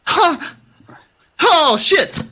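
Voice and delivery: high, jolted yelp; startled. Acted. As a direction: jump at being told to drop it. NPC startled, drops bottle.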